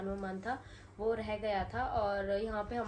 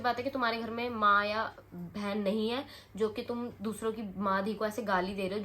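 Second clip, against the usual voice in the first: ordinary talk, nothing marked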